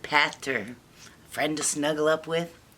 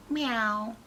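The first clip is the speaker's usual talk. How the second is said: small, high kitten meow; timid meow; 7 semitones higher than usual. Acted. Shy girl tries copying the courage roar, timid meow. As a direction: squeak out a feeble little meow.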